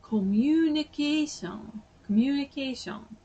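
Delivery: high Japanese-accented "komyunikeeshon". Shown as a demonstration. Showing how to be understood, in a Japanese accent. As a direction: stretch communication into even Japanese syllables, pitched high. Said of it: "with the Japanese accent".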